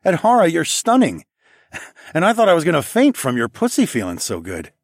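laughing. A narrator's reading. Laughing compliment.

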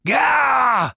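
Dave's sudden pained gah; pained cry. A narrator's reading. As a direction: yelp as the beard is yanked. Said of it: "cried Dave".

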